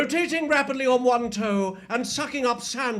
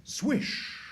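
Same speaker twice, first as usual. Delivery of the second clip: whispered wind swish; sandstorm swish; 6 dB quieter than usual. Acted. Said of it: "am playing the sandstorm".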